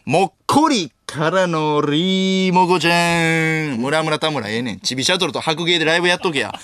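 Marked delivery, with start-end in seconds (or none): 0.1-3.8 very slow, drawn-out gag delivery; showy, elongated catchphrase with a long final note; comedian's catchphrase. Retold or imitated. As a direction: perform it big, stretching every part. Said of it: "名前なんでしたっけ？".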